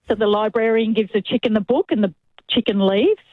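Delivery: ordinary delivery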